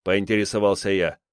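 usual speaking voice

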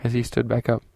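ordinary delivery

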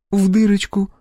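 put-on high, innocent girlish reply. A narrator's reading. Polina answering her irritated instructor, innocent. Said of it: "невинным голосом произнесла Полина".

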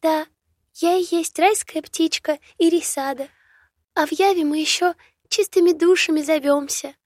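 high, bright birdlike chirp; chirping bird. A narrator's reading. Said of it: "прощебетала птичка". (щебечущая птичка)

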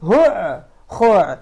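هع and خع each barked out separately from the throat; throat-sound demo. Shown as a demonstration. Teacher showing that all letters come from the throat, harsh throat sounds. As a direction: split هع / خع into two harsh throat bursts. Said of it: "مخرجها الحلق".

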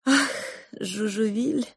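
sighing. Acted. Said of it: "вздохнула кухарка".

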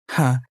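low, sharp scoffing breath; scornful exhale. A narrator's reading. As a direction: huff it out in contempt. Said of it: "выдохнул мужчина". (презрительный выдох)

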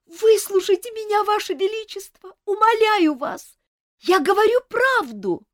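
high, loud sobbing plea; tearful pleading. Acted. Viscountess begging the king to listen, tearful plea. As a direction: beg the king through tears. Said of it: "рыдая".

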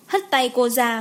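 plain reading voice

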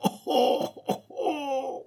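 high, scornful menacing laugh; disdainful laugh. Acted. Abbé laughing at Pitou, disdainful threatening laugh. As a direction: laugh him off with contempt. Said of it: "d'un rire tellement plein de dédaigneuses menaces".

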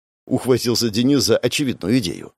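plain reading voice

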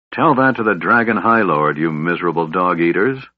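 usual speaking voice

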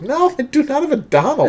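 high, fast squeaky duck voice; squeaky rubber duck. Pretend. Mimicking cartoon voice.

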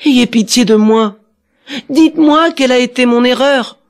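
high-pitched begging; pleading. A narrator's reading. Dwarf kneels before the prince, pleading high. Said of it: "implora son pardon".

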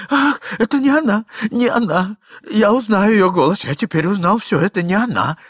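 high, loud, breathless outburst; agitated relief. Acted. Pale husband crying out that it's not her voice, high and loud. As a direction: blurt it out, shaken. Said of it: "сказал господин в енотах, побледнев как платок".

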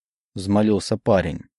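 plain reading voice

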